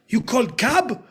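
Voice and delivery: cab hit high and loud. Retold or imitated. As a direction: push it out like a keen actor. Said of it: "I thought I'd make it a bit more".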